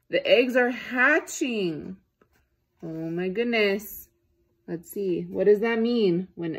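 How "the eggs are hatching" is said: high and slow, drawn out with wonder; piggy's slow excited news. A narrator's reading. Piggy telling elephant the eggs are hatching, slow and high. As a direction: stretch it like big news. Piggy voiced in read-aloud.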